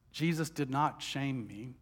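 usual speaking voice

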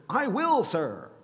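high, loud, eager yes; overly keen dutiful son; eager "I will". Pretend. Preacher voicing the second son's eager promise, loud and high. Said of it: "it's just kind of fun to say".

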